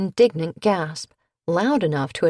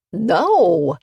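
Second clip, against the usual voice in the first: louder furious sputter; 7 dB louder than usual. A narrator's reading. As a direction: spit out the no. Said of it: "he sputtered".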